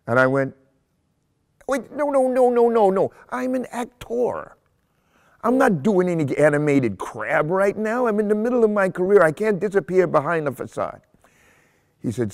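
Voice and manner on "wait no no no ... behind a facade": high-pitched, panicky mock refusal; hammed-up protest. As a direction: act out an indignant actor's squealing objection. Acts out protest to his agent.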